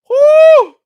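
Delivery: high, loud whoop of disbelief; a big exasperated whoo. Real, not acted. Exclaiming over the thick accent.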